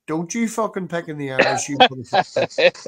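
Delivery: hammed-up indignant Irish defence; mock outrage.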